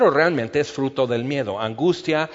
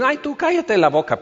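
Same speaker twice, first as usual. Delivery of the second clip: annoyed retort; 10 semitones higher, 3 dB louder than usual. Retold or imitated. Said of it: "pues dicen".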